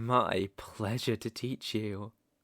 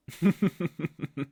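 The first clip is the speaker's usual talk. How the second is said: amused chuckle; 4 semitones higher, 4 dB louder than usual. Acted. Villain amused at guest.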